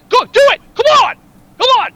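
loud high yell. Retold or imitated. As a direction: shout the dare at him. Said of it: "yelling at him".

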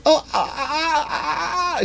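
high, drawn-out moaning ah sounds; imitating the leech woman's moans with a laugh; mock moaning noises. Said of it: "She's making those noises".

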